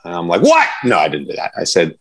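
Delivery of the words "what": mock irritated yell. Jokingly snaps at the custodian.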